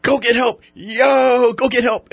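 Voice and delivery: yelling high and loud for help; desperate shout. Retold or imitated. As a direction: scream at the cat to go get help. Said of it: "He goes".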